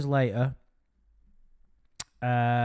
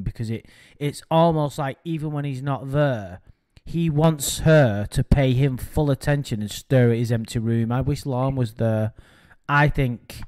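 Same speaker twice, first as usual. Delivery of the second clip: loud emphatic; 7 dB louder than usual. Real, not acted. Heated emphatic talk.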